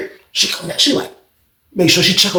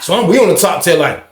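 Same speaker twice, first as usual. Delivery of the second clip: ordinary delivery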